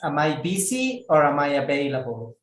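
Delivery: ordinary talk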